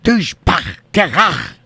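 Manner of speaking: a burst of made-up Klingon gibberish; mock Klingon. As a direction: spit out a throaty alien word, playful. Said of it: "Klingon sounds a lot like Russian".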